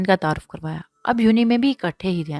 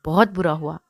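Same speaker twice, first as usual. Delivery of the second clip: plain reading voice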